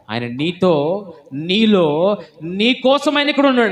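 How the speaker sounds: from slow to high and loud; building preaching. Acted. Preacher assures the church God is here, swelling to a shout. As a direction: rise to a triumphant claim.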